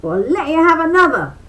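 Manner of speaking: high, loud, fast threat; frantic high rabbit shout; rabbit yelling. A narrator's reading. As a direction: rattle off a yelled threat.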